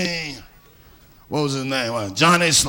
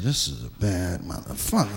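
hushed awe; 10 semitones lower, 7 dB quieter than usual. Retold or imitated. Awed remark about father.

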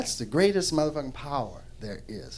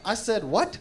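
indignant God; 7 semitones higher, 7 dB louder than usual. Acted. God angrily questions the preachers.

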